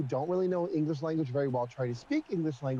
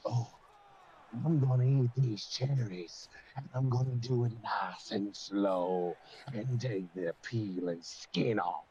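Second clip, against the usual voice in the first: slow, much lower sinister villain voice; evil-sounding delivery; 7 semitones lower, slower than usual. Shown as a demonstration. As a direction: make eating cherries sound menacing. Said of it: "and he still would sound like evil".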